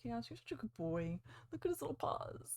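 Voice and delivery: soft doting coo over little paws; melting over a cute pet; overwhelmed by cuteness. Real, not acted. Cooing over her pets.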